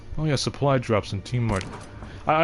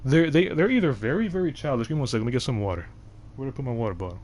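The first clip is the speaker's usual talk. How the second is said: usual speaking voice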